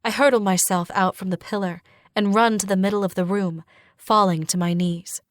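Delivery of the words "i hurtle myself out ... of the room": ordinary delivery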